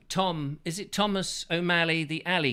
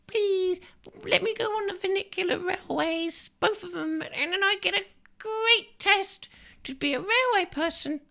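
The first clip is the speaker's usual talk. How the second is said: put-on much higher, pleading little boy; squeaky child. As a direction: beg like a small child in a squeaky voice.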